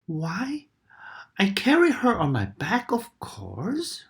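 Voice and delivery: a hint of a surprised crocodile. A narrator's reading. Crocodile answering Katy, lightly voiced.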